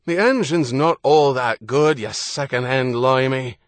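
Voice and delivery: loud mocking Irish retort; loud Irish shout. A narrator's reading. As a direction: shout back up with a jeer. John shouting back through a hailer.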